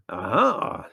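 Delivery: loud, relishing exclamation; savouring "aha". A narrator's reading. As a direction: pounce on it with delight. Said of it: "said Theodore with relish".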